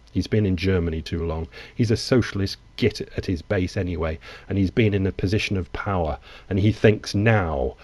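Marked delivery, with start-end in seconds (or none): none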